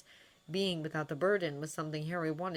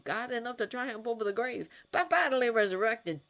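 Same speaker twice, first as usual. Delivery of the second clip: high, loud, fervent testimony; fervent believer; 7 semitones higher, 4 dB louder than usual. Acted. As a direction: preach it with joyful conviction. Doctor Robert's fervent faith speech.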